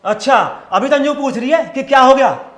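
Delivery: loud, irate retort; fuming. Acted. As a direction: fire it back at the wife, incensed. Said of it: "क्यों आग बबूला हो रहे हो?".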